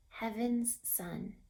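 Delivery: plain reading voice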